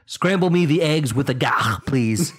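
loud, high, hammed-up Klingon request; mock Klingon order. Playing along with misheard phrase, loud mock-Klingon order. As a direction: bark breakfast like a Klingon.

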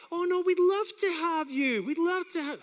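mock sing-out of hospitality; overly polite. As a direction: gush politely while thinking otherwise. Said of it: "type of polite people that are like".

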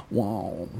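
soft, drawn-out wow; quietly impressed, stretched exclamation; Owen Wilson impressed. Acted. Showing how the actor sounds when impressed, soft wow. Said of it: "Oh, that's, that's pretty impressive".

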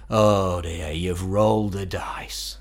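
slow, weary imitation of the exasperated manager; lightly voiced, resigned sigh of a phrase. Imitating the band's manager as he despairs at them, slow and resigned. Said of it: "Doug's famous phrase to us was".

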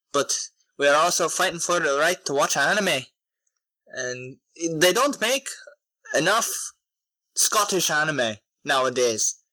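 playful put-on Scottish brogue; Scottish accent. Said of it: "Do, do your Scottish voice for him".

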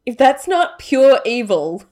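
loud, slow outraged declaration. Real, not acted. Outraged exclamation.

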